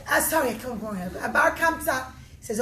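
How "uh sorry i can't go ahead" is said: plain reading voice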